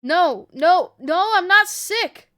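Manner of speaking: loud, high-pitched denial; panicked protest. A narrator's reading. Ellie denying the red scan result, panicked protest. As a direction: plead loudly, desperate to be believed.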